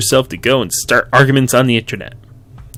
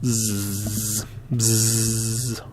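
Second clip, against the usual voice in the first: soft snoring sound; 11 dB quieter than usual. Acted. Falling asleep.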